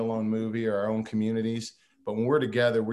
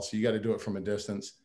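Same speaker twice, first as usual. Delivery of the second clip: ordinary delivery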